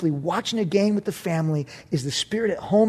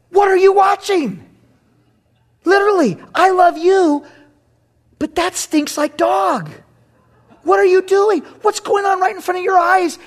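loud, much higher scolding voice; indignant rebuke; 10 semitones higher, 5 dB louder than usual. Retold or imitated. The Lord scolding a believer about his TV, loud and high. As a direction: scold like a disappointed father.

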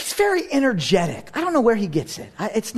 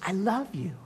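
much softer, intimate; tender murmur; 9 dB quieter than usual. Retold or imitated. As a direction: say it like a child's hug.

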